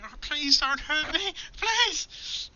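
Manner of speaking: high, frightened begging; terrified pleading. Acted. Cowering man pleads with the adventurers, high and frightened. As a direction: beg for mercy in a high panicked voice.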